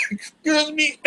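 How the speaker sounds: harsh hacking cough; real coughing fit, hacking it up. Real, not acted. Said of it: "Your host hacking up a lung".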